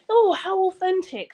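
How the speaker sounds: high, drawn-out gushing; mock awe. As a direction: gush like an easily impressed audience. Voices impressed, gullible American audience.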